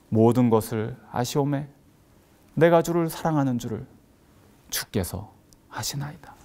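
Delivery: hushed acted confession, trailing into breath; humbled reply. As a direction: answer tenderly, sinking to a whisper. Peter's humbled answer to Jesus.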